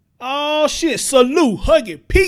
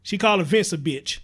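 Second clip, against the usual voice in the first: ordinary talk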